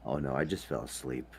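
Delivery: ordinary talk, nothing marked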